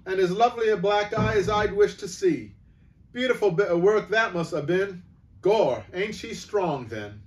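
high, loud cheeky cockney voice; amused onlooker calling to the shopkeeper; cockney bystander. Acted. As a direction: relish the scene, loud and common.

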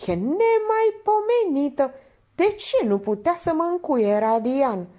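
very high, loud lament; exasperated. Acted. Painter wishing Radian had locked him in, exasperated. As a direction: cry out at the end of patience.

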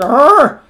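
loud high honking squawk; goose honk imitation. Imitating a fluttering prop as a goose honk. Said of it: "it sounds like a goose".

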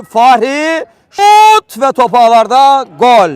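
goal call, shouted high and loud; screaming football commentator. Real, not acted. Penalty scored, commentator erupts.

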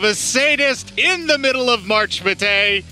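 high, slow mock-cheery show host; announcer voice. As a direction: welcome them like a game show host.

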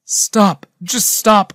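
high, loud cry to stop; shouted. A narrator's reading. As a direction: shout him down. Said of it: "I shouted".

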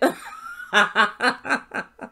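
long burst of laughter; rapid, helpless ha-ha-ha; real laugh. Real, not acted. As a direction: crack up at your own joke. Laughing at own joke.

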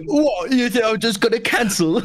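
mock angry English bloke; a touch of an irate English accent. S1 imitating S0 reading a donation, English accent. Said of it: "Your English accent, you make it sound like I'm really angry".

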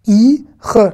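I and RE as two separate sounds, pause between; decomposed word. Shown as a demonstration. Teacher uncovering the word bit by bit, decomposed. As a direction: sound out "i, re" one piece at a time, re as a throaty "kh". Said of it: "vraiment tout décomposer".

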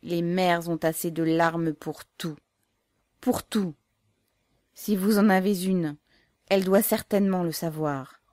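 rising, firm irritated retort; mother answering the officer angrily. A narrator's reading. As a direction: push back with hurt anger. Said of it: "de nouveau irritée".

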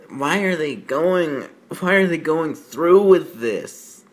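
ordinary talk

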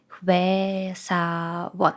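Хвесавон stretched very slowly syllable by syllable; very slow read. Shown as a demonstration. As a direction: draw out every part of Хвесавон. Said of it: "word demo".

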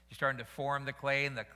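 normal talking voice

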